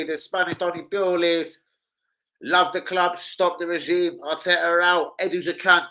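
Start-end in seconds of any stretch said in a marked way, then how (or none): none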